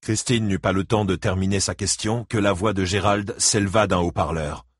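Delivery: usual speaking voice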